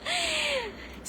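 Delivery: a high, squealing burst of laughter. Real, not acted. Vlogger laughing at her own gossip, squealing laugh. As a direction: let out a high giggle, falling away.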